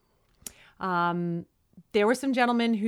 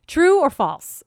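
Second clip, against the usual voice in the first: game show call; 7 semitones higher, 10 dB louder, slower than usual. Acted. Game segment announced by co-host.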